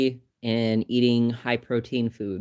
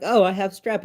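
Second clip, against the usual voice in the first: mock surprise; 8 semitones higher, 5 dB louder than usual. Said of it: "And I was like".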